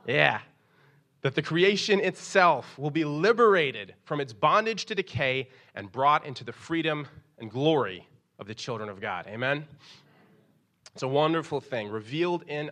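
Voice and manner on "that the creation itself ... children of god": scripture reading. Acted. Pastor reading Romans aloud to the congregation, preaching. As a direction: draw out the verse for the church.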